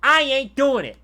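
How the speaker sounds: put-on loud, high-pitched kid refusing; defiant boy. Boy cemented to the road answers guards, defiant yell. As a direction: yell back as a stubborn young boy. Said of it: "And he's like".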